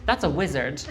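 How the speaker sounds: usual speaking voice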